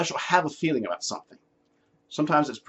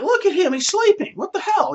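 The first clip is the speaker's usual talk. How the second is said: much higher, loud mock panic; panicked disciples; 4 dB louder than usual. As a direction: play the frightened disciples shrieking at sleeping Jesus. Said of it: "And they're like".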